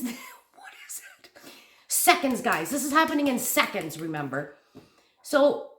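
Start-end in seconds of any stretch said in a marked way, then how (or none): 0.0-1.3 voiceless, drawn-out whisper; re-enacted hushed fright; scared whisper. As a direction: whisper it as if afraid.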